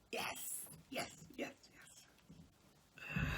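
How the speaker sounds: breathy whispered yes to himself. Acted. Baker whispering to himself over his perfect cake.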